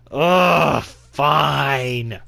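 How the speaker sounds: loud, groaned, exasperated giving in. Acted. Pushed into entering her room, exasperated. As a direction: groan it high and loud, grudgingly. Reluctantly agrees to go in.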